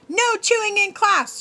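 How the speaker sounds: high, sugary scolding; sweetly menacing teacher. A narrator's reading. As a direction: smile while you threaten. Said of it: "she smiles".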